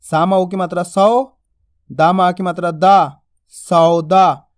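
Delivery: सौदा spelt out slowly, final दा stretched; slow teacher drill blending सौ and दा into सौदा; drawn-out reading. Shown as a demonstration. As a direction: drag the aa of दा long. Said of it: "खींच के पढ़ेंगे".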